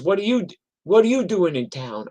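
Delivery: puzzled club owner. Retold or imitated. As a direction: sound caught off guard. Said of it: "He goes".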